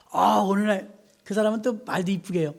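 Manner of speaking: usual speaking voice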